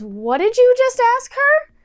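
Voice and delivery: imitating an incredulous challenge, high and loud; confronting worker. Worker confronts the creepy man.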